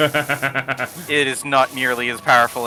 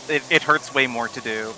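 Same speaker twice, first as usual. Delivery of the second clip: ordinary delivery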